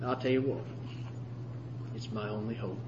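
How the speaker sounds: low, soft pulpit appeal with long pauses; reverent, subdued sermon close; hushed preaching. Acted. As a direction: let each phrase sink in quietly.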